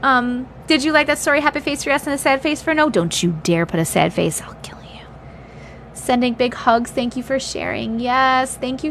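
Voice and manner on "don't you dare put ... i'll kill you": whispered playful threat; mock death threat. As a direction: hiss it as a joking menace. Mock threat over sad-face votes.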